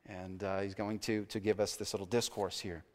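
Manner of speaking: ordinary talk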